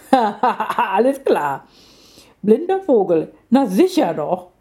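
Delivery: slow, laughing through the words; mock the bird while laughing; lion cub giggling in disbelief; laughing mockery. A narrator's reading. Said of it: "Sie kugelte sich vor Lachen".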